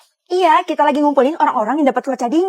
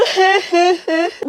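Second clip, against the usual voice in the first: fake crying harder; 3 semitones higher than usual. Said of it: "makin kejar aja nih anak nangisnya".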